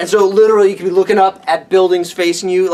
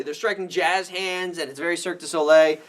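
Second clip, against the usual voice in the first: ordinary talk, nothing marked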